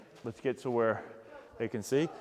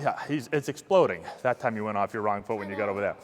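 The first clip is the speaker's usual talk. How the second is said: ordinary talk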